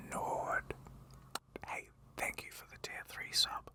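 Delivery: breathy ASMR whisper. Acted. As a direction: whisper softly like an ASMR stream. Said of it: "A S M R stream".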